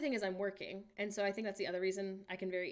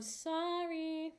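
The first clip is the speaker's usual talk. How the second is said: drawn-out apology; 8 semitones higher than usual. Real, not acted.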